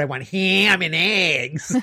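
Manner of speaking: loud, exaggerated American twang on "ham and eggs"; imitated American accent. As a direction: overdo the American vowel in "ham", loud and drawn out. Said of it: "he caught it when he heard me".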